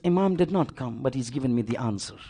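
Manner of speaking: soft, low, tearful answer; a weeping man barely getting the words out; weeping, hushed. Acted.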